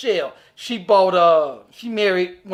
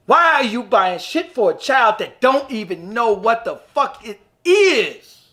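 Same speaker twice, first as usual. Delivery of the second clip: ordinary talk, nothing marked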